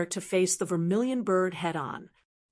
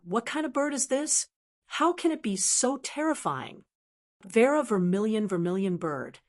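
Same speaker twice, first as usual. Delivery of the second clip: usual speaking voice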